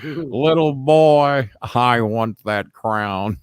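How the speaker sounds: very slow, drawn-out menacing demand; slow bullying wrestler voice; heel taunt. Retold or imitated. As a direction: stretch every word like a wrestling villain. Funk taunting, slowed drawl.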